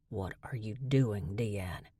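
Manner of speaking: low, soft, dangerously calm; quiet calm before the storm; hushed and even. A narrator's reading. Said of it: "His voice was calm".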